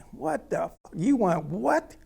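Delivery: high, indignant yell of disbelief; screaming back. Retold or imitated. Driver screaming back at the officer, incredulous shout. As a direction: yell it back, pitch leaping up. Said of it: "I'm screaming now back at him".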